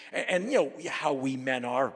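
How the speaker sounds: ordinary talk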